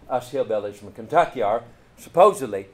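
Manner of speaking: usual speaking voice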